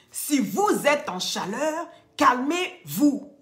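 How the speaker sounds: slow and loud, CALMEZ-VOUS spaced out; emphatic warning. Real, not acted. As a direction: hammer "calmez-vous" slowly at the audience. Warning viewers to calm down. (avertissement martelé, «calmez-vous» bien détaché)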